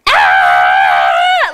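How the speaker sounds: low, loud imitated kid shriek; mock tug-of-war scream; kids' scream. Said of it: "they were doing this".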